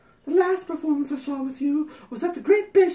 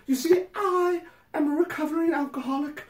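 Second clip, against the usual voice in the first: hammed-up letter voice; 4 semitones higher, 4 dB louder than usual. Reading the letter to Patty, hammed-up prim voice. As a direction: read it like a sanctimonious fan pouring her heart out. Reads fan letter aloud.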